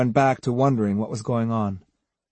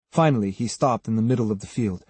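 plain reading voice

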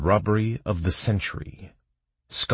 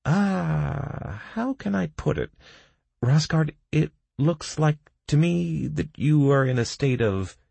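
high-pitched, halting character voice; high, hesitant delivery; hesitant diagnosis; 11 semitones higher than usual. A narrator's reading. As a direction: search for a tactful way to put it. Doc hesitantly gives his diagnosis.

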